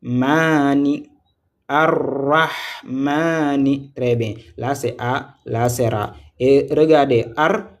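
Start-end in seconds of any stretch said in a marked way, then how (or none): 0.0-3.9 "Ar-Rahmani" drawn out very slowly; teacher giving the full word for pupils to copy; slow model pronunciation. Shown as a demonstration. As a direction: stretch "maa" and hold the rolled r. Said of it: "Regardez comment je prononce".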